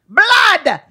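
put-on high, loud outburst in a Dracula-like Greek accent; bad Greek accent. Greek driver denouncing oil wars, loud bad Greek accent. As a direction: cry it out as a ranting Greek driver, hammed-up accent. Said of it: "I can't do the accent. I sound like Dracula".

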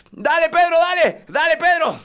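high, loud frantic yell; exasperated, over-invested dad yelling; desperate cheering. Acted. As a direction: scream encouragement at a losing kid. Acted dad yelling at son.

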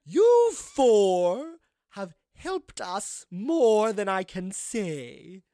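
very slow, high, swooping proclamation; ghostly madam. Acted. Ghost gifts the party a key, slow and ceremonious. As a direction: bestow it grandly, stretching each phrase. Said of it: "And she says".

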